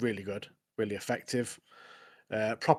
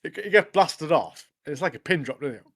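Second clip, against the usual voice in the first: normal talking voice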